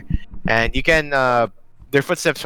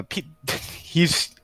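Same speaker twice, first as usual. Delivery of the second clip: normal talking voice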